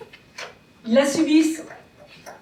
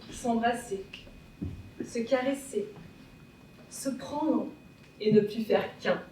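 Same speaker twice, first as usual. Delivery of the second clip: soft, low and intimate; quiet, loving wonder; tender, hushed admiration; 4 semitones lower, 7 dB quieter than usual. Acted. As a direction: lower the voice, caressing each phrase. Hands lovingly embracing, in contrast with feet.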